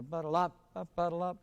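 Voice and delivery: "ba-da-la" leaning on the final "la"; stress on the last syllable. Retold or imitated. Director mimicking what the trumpets played, last-syllable stress. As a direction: imitate players weighting the end of "ba-da-la". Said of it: "And maybe the last".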